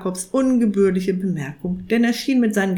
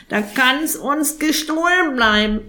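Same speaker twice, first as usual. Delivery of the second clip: high croaky raven, demanding; impatient raven; 3 semitones higher than usual. Acted. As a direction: insist on now.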